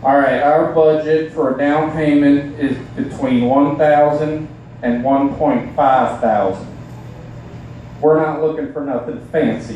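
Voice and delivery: slow hillbilly drawl; mock hillbilly. A hillbilly couple teasing a snobbish realtor, slow twang. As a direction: put on a hillbilly twang, slowly. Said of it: "just sit there like".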